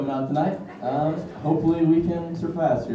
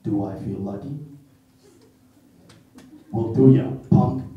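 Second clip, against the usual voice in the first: low, hushed Dirty Harry growl-free menace; softer, lower Clint Eastwood as Dirty Harry; Dirty Harry impression; 6 semitones lower, 8 dB quieter than usual. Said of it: "do some impressions".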